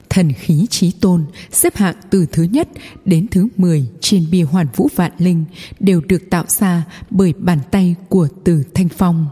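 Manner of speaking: plain reading voice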